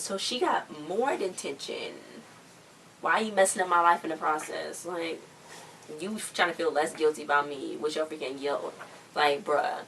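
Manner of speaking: ordinary talk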